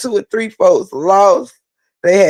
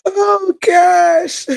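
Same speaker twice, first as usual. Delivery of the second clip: crying laughing; 10 semitones higher, much slower than usual. Real, not acted. Retelling punchline, breaks up laughing.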